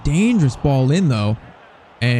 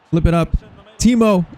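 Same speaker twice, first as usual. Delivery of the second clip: high, raised shout of the name; excited call; 5 semitones higher, 3 dB louder than usual. Real, not acted. Gamer urging his striker Timo to score, excited call. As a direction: call out Timo's name, higher and louder. Calls to player as he shoots.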